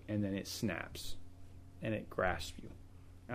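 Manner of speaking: softly ominous, fading to a whisper; hushed menace. Acted. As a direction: drop to a hushed, slow whisper on the grasp. Sin crouching, ready to pounce.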